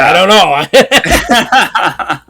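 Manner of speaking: loud, high, drawn-out and breaking into laughter; laughing mock doubt. Sceptic unsure about a fake Spanish accent, laughing mock doubt. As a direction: crack up while playing the doubter.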